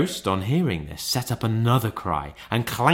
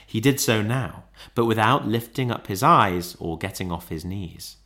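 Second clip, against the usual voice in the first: plain reading voice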